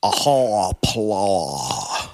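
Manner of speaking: low, slow Deep South drawl greeting; deep South accent. Shown as a demonstration. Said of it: "I'll put on my deep, deep South accent".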